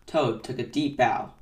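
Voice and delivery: ordinary delivery, nothing marked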